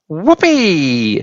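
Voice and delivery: a whooping cry in an odd voice; gleeful shout. A narrator's reading. Grandma shooting up through the floor, shouting with glee. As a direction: whoop with joy. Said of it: "she shouted".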